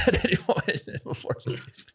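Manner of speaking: words breaking into laughter; laughing. Real, not acted. Joking with co-hosts about fewer jump shots, laughing. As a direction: talk through a laugh.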